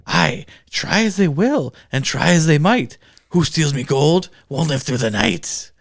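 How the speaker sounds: put-on slow, sing-song Irish leprechaun accent; Warwick Davis leprechaun. Reciting the leprechaun rhyme to Tyler in an Irish leprechaun accent. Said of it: "We'll see if I do it accent or not".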